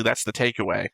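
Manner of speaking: ordinary delivery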